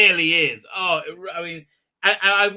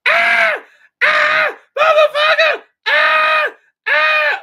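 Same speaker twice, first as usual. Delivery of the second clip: shrieking, much higher, much louder mock screams; hammed-up screaming; 12 dB louder, much slower than usual. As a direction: scream like a terrified B-movie heroine. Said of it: "now she's like".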